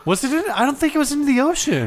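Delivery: high, quick, laughing objection; laughing disbelief. Real, not acted. Host correcting co-host about a plane crash, laughing disbelief. Laughing at co-host's blunt claim.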